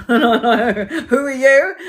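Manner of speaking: slow, drawn-out laughing question; laughing disbelief. Retold or imitated. Not recognising her own client, slow and laughing. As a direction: stretch it out through a laugh. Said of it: "laughs then asks".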